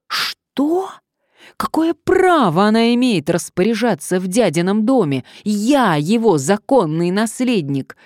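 high, loud indignant protest; outraged. A narrator's reading. Heir learns Lady Elizabeth gives orders here, outraged. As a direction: protest loudly as the wronged heir. Heir barred from uncle's house.

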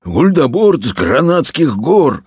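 ordinary delivery, nothing marked

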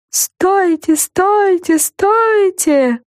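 high distant echoing call. A narrator's reading. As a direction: call out like a spooky echo. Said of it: "отозвалось зловещее эхо".

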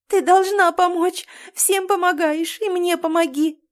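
high, loud cry for help; loud, high-pitched pleading; desperate shouted plea. A narrator's reading. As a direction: cry out a demand from the doorway. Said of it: "с порога прокричала она".

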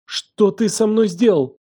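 high, slow horrified demand; trembling voice. A narrator's reading. Елена demands what he did to her, trembling. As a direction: draw it out high, close to panic. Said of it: "Голос её дрожал".

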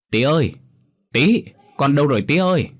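high, loud panicked call; alarmed calling out. Acted. Mother searching for her missing child, alarmed calling out. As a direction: call out anxiously, pitch jumping up. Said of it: "bà thốt thoảng kêu".

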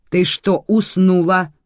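impatient, angry challenge; snapping boss. A narrator's reading. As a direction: jolt a daydreaming subordinate. Boss looms over employee, snapping.

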